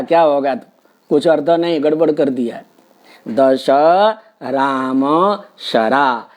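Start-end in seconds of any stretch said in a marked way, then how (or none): 3.3-6.2 दश राम शरा very slow, each word apart; separated words. Shown as a demonstration. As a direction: space the words out carefully. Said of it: "ऐसा पद विच्छेद".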